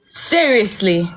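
slow, drawn-out, falling question; deadpan disbelief. Real, not acted. Storyteller dwelling on his nerve in calling, deadpan disbelief. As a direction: stretch it out and let it drop. Repeats it slower and lower.

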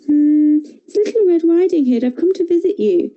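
put-on high, bright child's voice; lightly voiced young girl; little girl voice. A narrator's reading.

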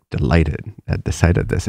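normal talking voice

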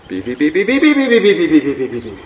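rapid beeps soaring up, then sinking and fading; imitated variometer swell. Shown as a demonstration. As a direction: mimic lift rising and dying away. Imitates variometer in second wave.

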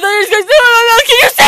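loud fast high yell; shouting. Real, not acted. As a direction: shout it out quickly. Repeats it louder.

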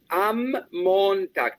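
"Am Montag" slow, M held long; stressed M. Shown as a demonstration. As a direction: lean on the M of "Am". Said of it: "con una M bien acentuada". ("Am Montag" con la M bien acentuada)

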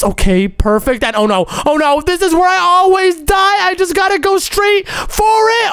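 usual speaking voice

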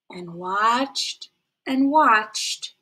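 very slow, stretched words; drawn out. Shown as a demonstration. Storyteller showing patient waiting, very slow. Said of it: "teacher stretches patience".

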